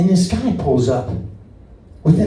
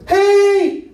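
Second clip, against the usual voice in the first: long, very high warning holler; shrill-pitched mock road yell; high-pitched "hey" yell. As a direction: holler it high at another driver. Driver yelling at car drifting over.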